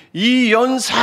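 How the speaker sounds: high, drawn-out imitated declamation; climactic orator's cry. Preacher likening Paul to a contest orator, climactic cry. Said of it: "하면서 클라이막스 절정에".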